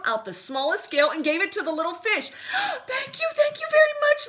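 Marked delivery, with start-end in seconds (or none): 2.3-2.8 very high laughing gasp; delighted gasp. Acted. Little blue fish gasping with delight at his scale. 2.9-4.3 very high, loud happy thanks; bubbling playfully. Acted. Little blue fish thanking Rainbow Fish, bubbling with joy. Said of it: "bubbled playfully".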